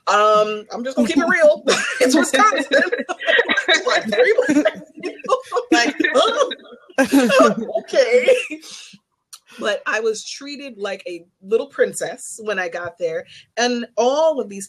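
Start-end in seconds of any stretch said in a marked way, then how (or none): 2.0-8.4 high, laughing disbelief; giggling high-pitched aside. Real, not acted. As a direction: laugh through it, amused and candid. Laughing about being outnumbered.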